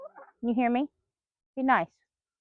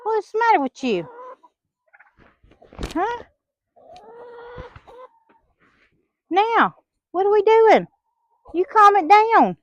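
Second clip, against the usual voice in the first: pitched way up, fussing at the hens; high exasperated scolding; 9 semitones higher than usual. Real, not acted. Owner fussing at quarreling hens, high and exasperated.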